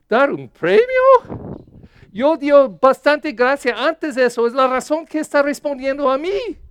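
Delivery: God laughing at the idea of a prize; amused God. Retold or imitated. God answers the bargaining believer with high, loud amusement. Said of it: "Él está riendo".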